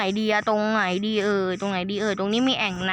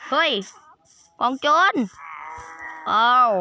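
startled shout; 4 dB louder than usual. Real, not acted. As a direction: yell out as the raiders appear. Raiders suddenly appear in game.

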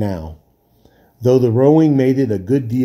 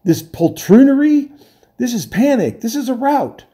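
panicked; 12 semitones higher, 3 dB louder than usual. Acted. Panicked sailor.

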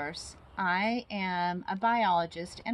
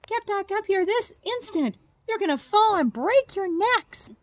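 much higher, loud frantic scolding; scolding mother bird; 13 semitones higher, 10 dB louder than usual. Acted. Mother bird scolding her chicks off the edge, frantic. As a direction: yell at the kids to get back now. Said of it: "she cried".

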